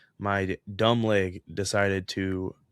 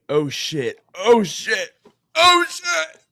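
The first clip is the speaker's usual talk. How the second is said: louder and higher each time, hammed-up pain; escalating mock agony; 12 semitones higher, 9 dB louder, slower than usual. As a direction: build from raised voice to high squeal. Acting out agony from leg cramp.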